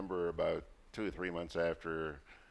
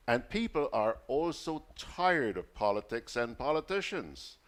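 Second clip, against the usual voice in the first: higher, projected on-air read; raised-pitch broadcast reading voice; reading a message aloud; 7 semitones higher, 4 dB louder than usual. Real, not acted. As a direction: read a listener's comment out emphatically. Reads a listener's message aloud.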